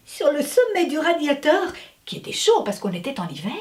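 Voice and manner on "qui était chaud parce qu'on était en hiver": usual speaking voice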